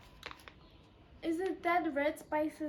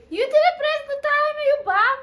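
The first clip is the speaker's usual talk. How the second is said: high, loud telling-off; loud scolding yell; 10 semitones higher, 13 dB louder than usual. Real, not acted. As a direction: yell at him for forgetting. Partner forgot the timer.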